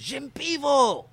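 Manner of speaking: loud, high drawn-out foreign shout; screaming bar patron. Retold or imitated. Man in a Krakow bar hollers at a baffled visitor, screaming. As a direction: imitate a rowdy bellow across the bar. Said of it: "they're screaming".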